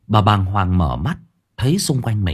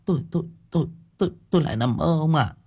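higher, softer, stammering in fright; a frightened wife stammering to her husband; 6 semitones higher, 5 dB quieter, much faster than usual. A narrator's reading. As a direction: stutter it out, still shaken. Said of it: "Bà Sửu nhìn chồng".

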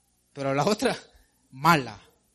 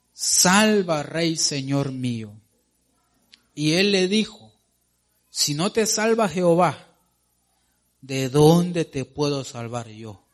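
booming pulpit reading; emphatic scripture reading; 5 dB louder than usual. Acted. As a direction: read it like a preacher building the scene.

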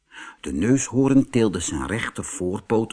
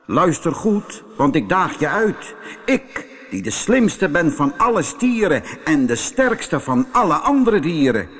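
boastful bull; 5 semitones higher than usual. Acted. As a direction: crow like a proud, bossy bull. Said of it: "Hij bleef staan en zei".

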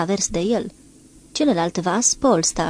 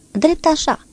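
higher clipped helm command; curt order; 9 semitones higher, slower than usual. A narrator's reading. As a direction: snap the order at the helmsman. Captain orders helm steady. (ordin scurt al comandantului)

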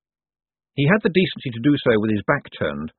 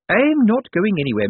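high, louder, firm; determined nurse; 10 semitones higher, 4 dB louder than usual. A narrator's reading. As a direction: stand her ground. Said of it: "a study in determination".

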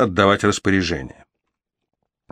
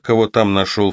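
usual speaking voice

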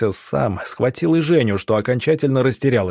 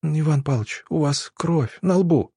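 trembling voice; 5 semitones higher than usual. A narrator's reading. Zhenya tells the doctor he is bleeding, mildly trembling. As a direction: gently point it out, a little scared. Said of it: "дрожащим голосом произнесла Женя".

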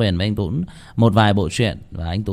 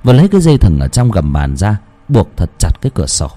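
louder, measured storytelling voice; horror story narration; 5 dB louder than usual. A narrator's reading. Narrator telling listeners the creepy scene, in a dark storytelling voice. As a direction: read it like a spooky tale by night.

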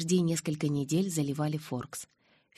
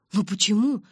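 louder, higher question with a rising tune; demanding explanation; 4 semitones higher, 6 dB louder than usual. A narrator's reading. Mike demanding explanations from her, insistent. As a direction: press for a reason, protesting. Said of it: "потребовал объяснений Майк".

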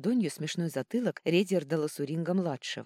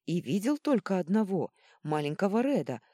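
loud, raised protest; yelling back; 4 semitones higher, 6 dB louder than usual. A narrator's reading. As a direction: keep shouting your defence. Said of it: "завопил в ответ мэтр Жужжин". (громко, в крик)

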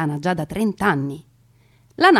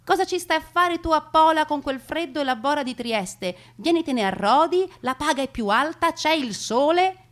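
put-on lighter, higher voice of the older sister coaxing; a hint of a cheerful sister calling her south; sister's letter voiced; 7 semitones higher, 3 dB louder than usual. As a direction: read the letter as Emma, inviting and sunny. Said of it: "le ha scritto in varie lettere".

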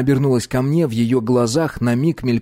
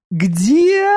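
put-on bright high young woman; high girl voice; 13 semitones higher, 5 dB louder than usual. Dominika voiced, loud.

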